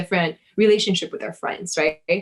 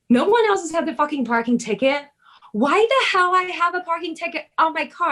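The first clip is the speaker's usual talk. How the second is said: raised-pitch irritated rant about the ticket; retold angry rant; 9 semitones higher than usual. Friend retelling her parking-ticket fury, mock angry rant. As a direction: vent it like you just found a ticket, pitched up. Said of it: "And I was pissed off".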